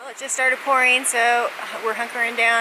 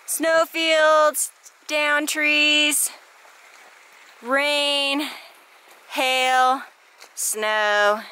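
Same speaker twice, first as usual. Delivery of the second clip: excited list; 4 semitones higher, much slower than usual. Real, not acted. Listing the hike's hardships.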